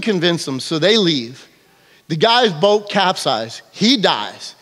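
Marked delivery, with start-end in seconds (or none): none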